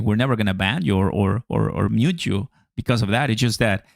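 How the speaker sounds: normal talking voice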